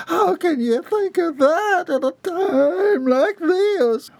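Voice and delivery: very high, slow sobbing plea; crying out. A narrator's reading. Bobby Sue bursting into tears at Jeb, high sobbing. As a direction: sob it out, high. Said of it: "burst into a fresh set of tears".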